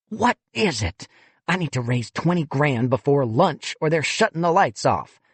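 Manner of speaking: a touch of an exasperated development director; irritated fundraiser. Acted. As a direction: shut him down, fed up.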